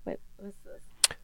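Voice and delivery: slow hushed whisper. Real, not acted. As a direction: breathe it under your breath. Muttered aside while thinking.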